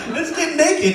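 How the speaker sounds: put-on high-pitched gleeful kid; mock eager excitement. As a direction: jump at the dare with glee. Kid eagerly accepts the dare.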